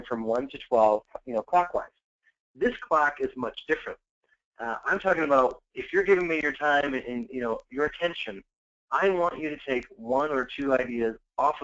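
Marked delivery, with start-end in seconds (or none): none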